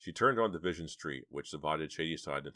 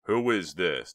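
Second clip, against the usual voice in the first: loud, sharp demand; 2 semitones higher, 9 dB louder, slower than usual. Acted. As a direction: demand to know who it is. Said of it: "demanded Chuck".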